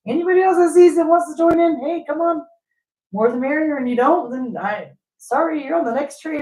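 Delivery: upbeat mock carefree person; cheerful invite. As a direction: wave everyone aboard, carefree. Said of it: "They just think".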